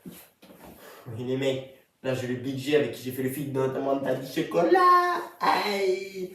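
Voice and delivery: ordinary talk, nothing marked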